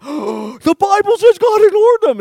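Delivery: high, loud shocked squeal; mock shock. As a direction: play the stunned churchgoer, hammed-up. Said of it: "You'd be like".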